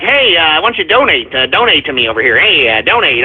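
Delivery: mocking donation beggar. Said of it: "people that are like".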